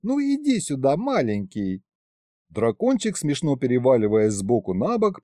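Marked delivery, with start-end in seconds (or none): 0.0-1.8 put-on baby talk starting very high, then dropping; cooing. A narrator's reading. Woman coaxing a baby dragon closer, cooing. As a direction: coax the little one with a high coo. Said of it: "засюсюкала Варя".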